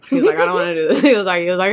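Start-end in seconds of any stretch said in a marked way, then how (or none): none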